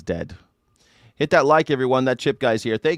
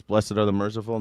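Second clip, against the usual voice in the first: usual speaking voice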